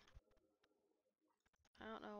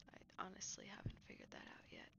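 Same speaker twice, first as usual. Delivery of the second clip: whispering; 5 dB quieter than usual. Real, not acted. Late night, quiet aside.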